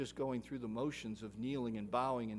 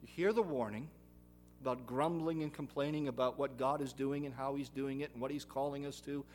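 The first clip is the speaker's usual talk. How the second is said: ordinary delivery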